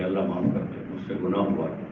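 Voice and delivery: low, softened plea for forgiveness; humble repentance. Acted. Righteous people asking Allah to forgive them, humble soft plea. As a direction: plead softly and low, lightly acted. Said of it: "معافی مانگتے ہیں کہ".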